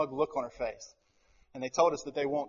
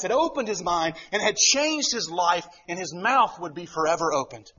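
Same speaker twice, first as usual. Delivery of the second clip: high, loud, forceful sermon climax; emphatic pulpit delivery; impassioned preaching; 8 semitones higher, 6 dB louder than usual. Real, not acted. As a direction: preach it with full force, driving each phrase.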